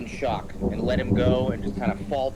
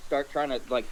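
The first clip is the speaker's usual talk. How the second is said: ordinary delivery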